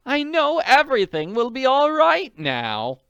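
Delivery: gushing with relief; relieved society lady. Acted.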